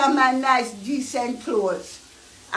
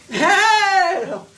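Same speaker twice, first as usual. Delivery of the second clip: outburst; 6 semitones higher, 4 dB louder than usual. Real, not acted. Sudden outburst.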